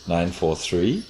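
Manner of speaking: "nine four three" ending with a rise on "three"; rising at the end. Shown as a demonstration. Demonstrating the rising condition to the interviewer. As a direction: lift the pitch on "three" like an unfinished list. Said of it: "instead of a falling pitch at the end".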